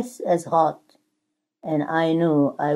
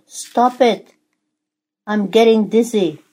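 louder, higher cry of protest; screamed protest; 6 semitones higher, 7 dB louder than usual. A narrator's reading. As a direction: cry out as the dizzy teacup. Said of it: "I screamed".

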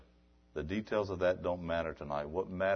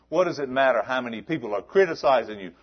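the question repeated louder and faster; escalating preacher's question; rising louder; 6 semitones higher, 9 dB louder, faster than usual. Acted. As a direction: build the question up. Rhetorical build.

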